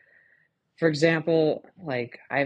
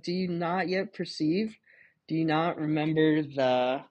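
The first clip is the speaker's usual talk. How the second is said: Jesus retold; 5 semitones higher than usual. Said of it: "he's like".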